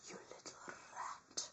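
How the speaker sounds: menacing whisper. Pretend. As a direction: hiss it to the snitch. Whispered insult.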